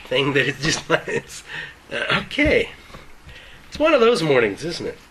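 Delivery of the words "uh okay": normal talking voice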